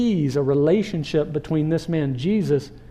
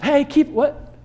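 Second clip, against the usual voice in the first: much higher startled hey, then sheepish okay; caught-out backpedal; 4 semitones higher, 5 dB louder than usual. Retold or imitated. Crowd caught shushing him, flustered backpedal. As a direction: stop mid-scold and backtrack awkwardly. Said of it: "they're, like".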